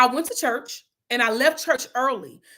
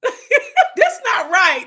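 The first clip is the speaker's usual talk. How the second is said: laughing; 10 semitones higher, 7 dB louder, much slower than usual. Real, not acted. Laughing then repeating herself.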